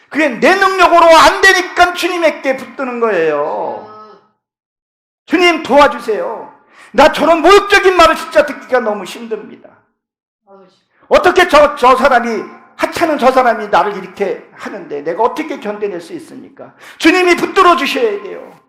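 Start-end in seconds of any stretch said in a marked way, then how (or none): 5.3-16.7 higher, louder anguished plea to the Lord; crying out in prayer. Acted. A believer pleading with the Lord, higher, louder, anguished. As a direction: cry out to God for strength to endure the insult. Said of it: "울부짖고 기도하는 거예요".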